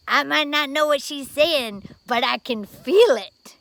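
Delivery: loud, slowing down to the punchline; firm conviction. Retold or imitated. Reverend answering his doubting colleague, loud and slow. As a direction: declare it slowly and loud from the heart.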